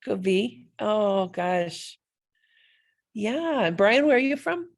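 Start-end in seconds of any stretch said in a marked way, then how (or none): none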